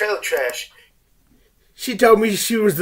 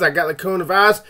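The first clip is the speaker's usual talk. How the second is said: ordinary talk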